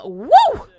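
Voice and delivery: loud high flirty whoop; excited whoop. Real, not acted. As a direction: let out a delighted catcall woo. Flirting with a hot Sim.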